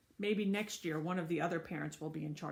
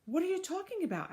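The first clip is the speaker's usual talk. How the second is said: indignant protest; 11 semitones higher, faster than usual. Acted. Accused man protests.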